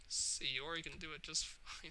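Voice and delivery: a sunk, quiet echo of the line; quiet bitter repeat. Acted. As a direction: murmur it to yourself. Repeats softly.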